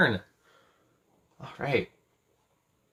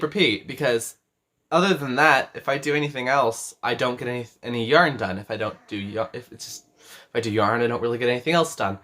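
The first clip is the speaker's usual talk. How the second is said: normal talking voice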